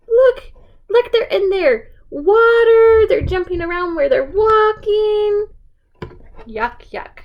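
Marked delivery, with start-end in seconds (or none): 0.1-5.5 high, loud, excited pointing; playful storybook excitement. Acted. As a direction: gasp with delight at the frogs. Excited frogs everywhere for toddler.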